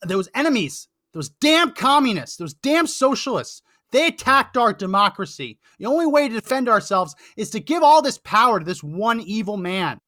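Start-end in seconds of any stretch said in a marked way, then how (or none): 0.1-7.6 mock propaganda rant. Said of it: "I say the, the".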